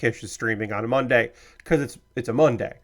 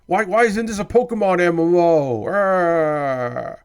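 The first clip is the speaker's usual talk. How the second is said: higher-pitched mock complaint trailing into a groan; mock outrage; 4 semitones higher, slower than usual. Streamer mimicking a disappointed fan, mock-outraged whine. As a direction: whine it like an aggrieved gamer. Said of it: "And you're like".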